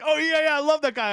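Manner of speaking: high-pitched gushing imitation; imitated fan enthusiasm. Imitating phone lady praising a streamer, high gushing. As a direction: play the delighted fan in a high voice.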